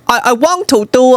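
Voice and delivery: high, exaggerated Chinese-accented English. Shown as a demonstration. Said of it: "do my accent".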